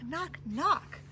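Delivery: ordinary delivery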